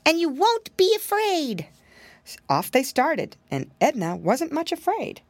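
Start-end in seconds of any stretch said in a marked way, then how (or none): 0.1-1.7 higher, lightly voiced little pig; wary piggy boy musing to himself; lightly raised boyish piglet voice; piggy boy thinking. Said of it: "exclaimed Curly Tail".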